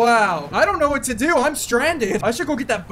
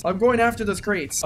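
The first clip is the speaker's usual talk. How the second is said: ordinary talk, nothing marked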